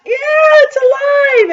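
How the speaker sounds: very high, loud panicked shriek; scream. A narrator's reading. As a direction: scream it out in high-pitched alarm. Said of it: "The man screamed".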